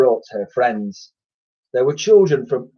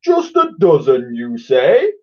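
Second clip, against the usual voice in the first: louder, teasing creature voice; mischievous; 5 dB louder than usual. A narrator's reading. Slime asking the boy mischievously. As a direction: tease the boy with it. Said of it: "asked Slime mischievously".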